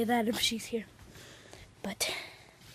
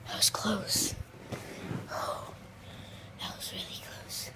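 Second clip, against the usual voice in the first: slow breathless whisper of relief; relieved whisper; 2 semitones lower, 5 dB quieter, much slower than usual. Real, not acted. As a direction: whisper it, still shaken. Relief after narrow escape.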